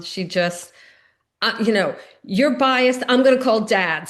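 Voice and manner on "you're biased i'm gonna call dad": louder, higher, snapping mock outburst; mimicked angry daughter. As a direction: play the upset daughter lashing out. Daughter hangs up in anger.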